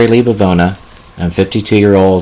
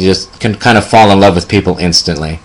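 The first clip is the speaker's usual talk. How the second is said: ordinary delivery, nothing marked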